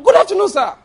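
high, loud startled "Good afternoon, sir"; startled greeting. Pretend. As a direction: jump up and greet the intruder. Startled board members greeting him.

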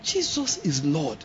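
slow, soft awed praise; soft declaration. Shown as a demonstration. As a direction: murmur it slowly in wonder. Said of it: "If I have to explain".

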